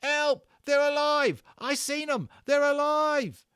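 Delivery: high, loud terrified yells; a skinhead yelling in terror at living soldiers; panicked yelling. A narrator's reading. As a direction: scream it while running in panic.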